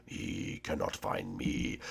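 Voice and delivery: slow, low secret whisper. Acted. Hiding player whispers that the seeker cannot find him. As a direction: breathe it so the seeker can't hear.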